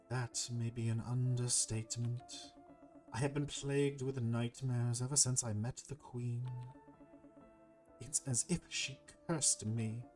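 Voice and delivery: measured, formal serpent voice; troubled serpent. Acted. Cursed serpent confides his nightmares to the hero, solemn and measured. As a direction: recount the curse with solemn deliberation.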